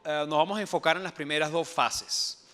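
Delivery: ordinary delivery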